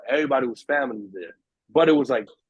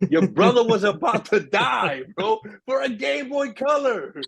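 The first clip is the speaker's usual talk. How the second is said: exasperated outburst; 8 semitones higher, slower than usual. Real, not acted. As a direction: let the outrage spill out at the brother. Exasperated at cowardly brother.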